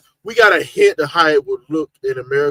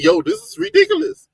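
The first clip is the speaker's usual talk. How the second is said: mock exasperation. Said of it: "It was like".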